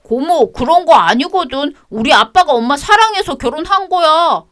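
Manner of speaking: high, sulky schoolboy answering back; child's defiant voice. Acted. Grandson snapping at his grandmother, high child voice. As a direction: talk back like a stubborn kid.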